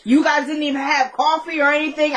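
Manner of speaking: usual speaking voice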